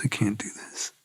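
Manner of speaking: soft unvoiced whisper, giving up; whispered despair. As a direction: barely breathe the words out. Breaking down in detox.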